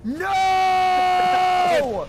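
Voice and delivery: a sudden high, loud scream of no; shouted no. Real, not acted. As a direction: yell it out in alarm.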